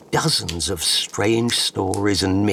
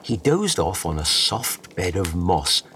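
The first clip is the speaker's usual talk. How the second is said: ordinary delivery, nothing marked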